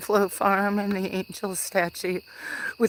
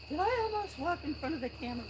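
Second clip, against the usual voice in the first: quick, high, hushed worry; anxious hushed question; worried hush; 9 semitones higher, 8 dB quieter, much faster than usual. Real, not acted. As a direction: fret under your breath, fast. Worried whisper about camera.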